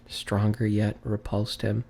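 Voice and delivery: usual speaking voice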